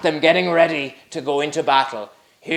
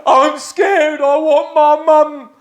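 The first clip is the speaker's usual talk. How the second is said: put-on high, louder babyish whimper; crying like a baby; 11 semitones higher, 7 dB louder, slower than usual. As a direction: whine like a scared toddler. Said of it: "crying like a baby".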